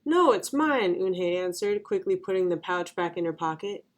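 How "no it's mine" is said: higher, quick-to-claim protest; protective reply. A narrator's reading. Eunhae guards her pouch from the kids, protective. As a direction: lightly protest that it belongs to you.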